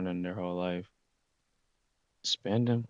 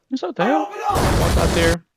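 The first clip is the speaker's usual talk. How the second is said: startled shout; 14 semitones higher, 6 dB louder than usual. Retold or imitated. Startled by someone on the ship.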